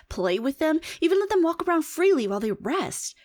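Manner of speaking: だんだん高く、信じられない様子で; 声を上げて呆れ返る; incredulous rise. Acted. Incredulous listing of pet care.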